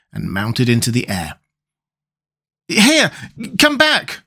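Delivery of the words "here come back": very high-pitched panicked call; frightened cry. A narrator's reading. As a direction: call it back in alarm. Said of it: "cried Tip in a frightened voice".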